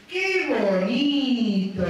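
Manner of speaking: drawn-out, delighted surprise, very slow; alegría y sorpresa. Shown as a demonstration. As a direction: stretch each syllable in exaggerated, joyful wonder. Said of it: "De alegría, de sorpresa". (exclamación alargada de alegría y sorpresa)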